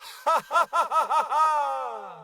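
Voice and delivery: high, rich laugh of pure enjoyment and pride; proud laugh. A narrator's reading. Great One laughing in proud triumph. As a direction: throw your head back and laugh in triumph. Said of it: "threw back her head and laughed".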